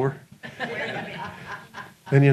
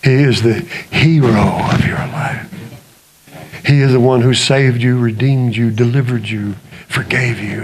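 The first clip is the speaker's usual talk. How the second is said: slow, intense sermon building to a hushed close; earnest preacher lowering to a hush; preaching; 7 semitones lower, slower than usual. Acted. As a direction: preach it, then drop to a whisper. Preaching to congregation.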